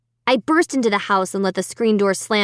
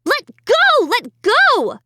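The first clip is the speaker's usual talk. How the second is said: desperate shriek; 14 semitones higher, 4 dB louder, slower than usual. A narrator's reading. Girl pulling dummy's fingers.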